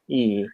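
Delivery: E said with lips pulled back wide to the sides; lips spread back. Shown as a demonstration. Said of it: "you have to pull your lips back".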